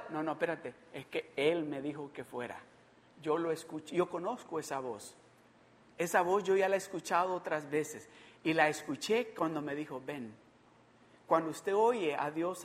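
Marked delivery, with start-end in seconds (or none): 0.1-10.3 soft, low, certain reply; calm assurance. Acted. Peter answering that he knows that voice, calm. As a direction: answer the alarm quietly and lower.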